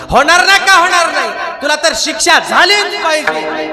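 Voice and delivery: loud, high, angry rebuke of a wrongdoer; angry scolding. Acted. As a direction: shout him down in fury. Mambaji scolding Tukaram. (संतापाने ओरडून रागावणे)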